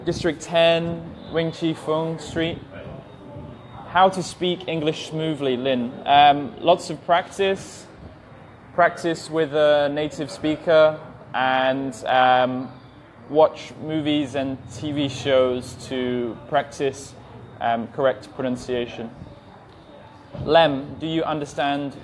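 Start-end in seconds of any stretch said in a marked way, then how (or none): 0.1-2.6 slow, careful address spelled out for viewers; slow it right down so viewers can follow; speaking slowly. Real, not acted. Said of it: "Can you speak slowly?". 6.6-19.1 slow, deliberate advice to learners; slow, careful advice. Real, not acted. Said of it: "Can you speak slowly?".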